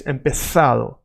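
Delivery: "empezado" with the Z as TH; Spain pronunciation. Shown as a demonstration. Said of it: "in Spain is empezado".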